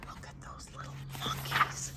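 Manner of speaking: soft whispered aside; hushed wonder. Acted. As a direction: whisper it as if spotting something secret. Hushed aside on spotting monkeys.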